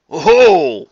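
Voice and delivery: loud pleased exclamation; light up in delight. Acted. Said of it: "was the response he received".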